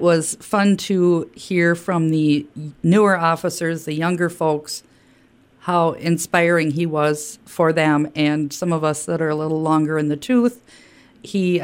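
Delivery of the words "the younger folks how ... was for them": ordinary talk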